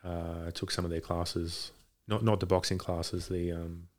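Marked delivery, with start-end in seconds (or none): none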